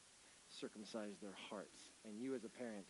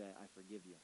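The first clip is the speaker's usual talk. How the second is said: gentle forgiveness; 3 semitones lower, 6 dB quieter than usual. Pretend. Voicing gentle words of forgiveness.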